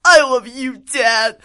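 loud, high, earnest cartoon teen; hammed-up filial devotion; gushing son. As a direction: shout your love to dad in a high voice. Acts Alucard answering his father.

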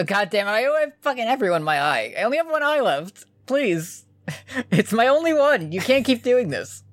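high, fast panicked begging; desperate pleading. Acted. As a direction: beg for your last eye in a panic. Pleading as a character.

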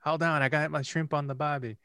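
a high, hammed-up fake Australian accent; mock Australian accent. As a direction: play a cartoon Aussie at a barbecue. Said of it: "a terrible character accent".

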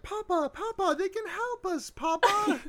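put-on high, pleading little boy calling his papa; posh little child. A child begs his papa to accept help, high and pleading. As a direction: squeak it out like a spoilt rich kid tugging at his father. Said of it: "some little Lord Fauntleroy child is like".

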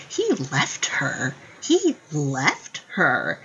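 slow, stressed indignant repeat; mom's mild indignation. Retold or imitated. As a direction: lean on it like an annoyed mom. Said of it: "she gets so mad".

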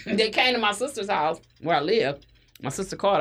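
ordinary talk

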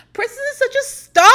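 high, loud gushing praise; mock gushing brother. Imagining her brother on video, mock high gushing. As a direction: play a brother gushing over his sister. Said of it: "one of them being like".

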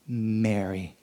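soft, low calling of a name; a quiet loving call; tender call. Acted. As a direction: say her name gently. Jesus calls her name softly.

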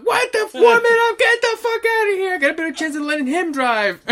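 high, very loud incredulous outburst; furious gangster yell. Retold or imitated. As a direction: imitate a gangster blowing up at his accomplice. Acting out furious gangster scolding accomplice.

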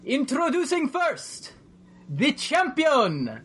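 high, drawn-out ring announcer's call; wrestling announcer. Acted. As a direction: stretch each word out like a wrestling announcer. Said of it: "you hear an announcer's voice".

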